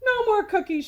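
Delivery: loud, high shout; boy shouting at his sweet tooth. Acted. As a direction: lay down the law at full voice. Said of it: "shouted Stuart".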